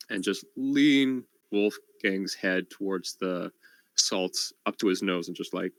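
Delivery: ordinary talk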